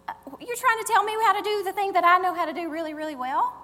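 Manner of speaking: high, loud, rushed indignant protest; wounded pride. Acted. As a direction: bristle at being told how to do it. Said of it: "Our pride goes up".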